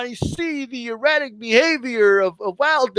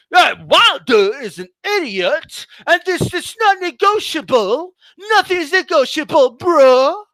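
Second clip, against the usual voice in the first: raised, higher mock rant of the opponent; hammed-up stubborn shout, pitched up; 6 semitones higher than usual. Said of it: "he was like".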